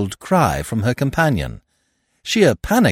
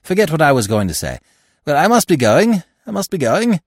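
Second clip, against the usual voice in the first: fast, louder flustered exit; hurried retreat; 7 semitones higher, 5 dB louder than usual. A narrator's reading. Keeble backing away from Eve, fast flustered retreat. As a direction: rush the words to escape.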